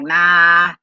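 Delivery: a sudden loud flat "Nah"; loud mock refusal. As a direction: bark the refusal. They refuse, acted quote.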